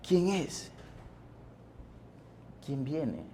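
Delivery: softly voiced wondering question; hushed questioning. Blind Bartimeo asking who is passing, hushed questioning. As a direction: ask it quietly, as if unsure who is there.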